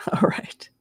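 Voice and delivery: whispered aside to herself. Real, not acted. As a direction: breathe it without voice. Quiet aside while settling.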